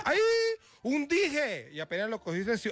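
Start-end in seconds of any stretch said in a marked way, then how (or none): 0.0-1.7 high, drawn-out mock wonder; delighted discovery. Passer-by spotting a pendant on the street, delighted. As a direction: exclaim high as if finding treasure. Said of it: "apenas vio eso y dijo".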